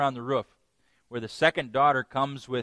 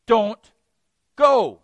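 loud, high, separated words; emphatic command; 8 semitones higher, 13 dB louder than usual. Retold or imitated. God's first answer to Balaam, forceful command. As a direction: punch each word as a firm order.

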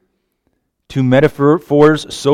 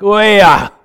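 Oya said louder and higher, hit hard; emphatic oya; 8 semitones higher, 7 dB louder than usual. Shown as a demonstration. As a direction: punch out Oya like a groan of the soul. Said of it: "In fact, it's emphatic".

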